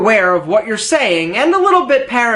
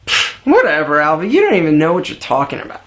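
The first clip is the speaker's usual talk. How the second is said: dismissive viewer; 7 semitones lower, faster than usual. Retold or imitated. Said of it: "if you're saying to yourself".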